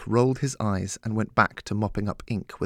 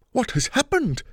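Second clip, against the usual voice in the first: high-pitched outraged outcry; shocked bellow; 4 dB louder than usual. A narrator's reading. Stepson demands to know about the mess, bellowing. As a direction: cry out in horrified surprise. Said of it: "he bellowed".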